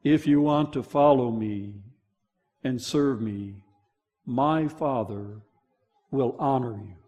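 low, soft and gentle, as Jesus speaking; Jesus' voice. Acted. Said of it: "Jesus' voice".

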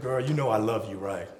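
a low loving Adam to Eve; tender Adam. Retold or imitated. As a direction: coax her gently. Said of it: "Adam said".

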